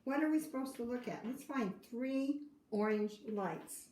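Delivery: ordinary delivery, nothing marked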